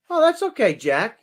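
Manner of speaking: mock clerk voice. Clerk voiced in a retold story.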